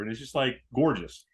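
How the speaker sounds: ordinary talk, nothing marked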